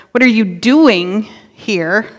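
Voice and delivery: DOING lifted and stretched out; slow question leaning on "doing", slight gap before "here"; stress on DOING. Shown as a demonstration. Preacher shows congregation how emphasis changes the question, stress on DOING. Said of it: "depending on what word you emphasize".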